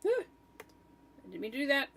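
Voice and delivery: real alarm at a wrong click; startled oops. Real, not acted. Accidentally clicks the wrong thing.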